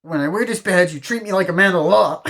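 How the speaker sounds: high, loud, stammering protest; indignant character impression. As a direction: mimic a wounded, self-important man demanding respect. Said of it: "It's just the way he says it".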